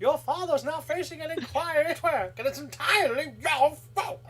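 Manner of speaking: shrill, scolding howler letter voice; howler mother voice. Retold or imitated. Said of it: "that is the vibe".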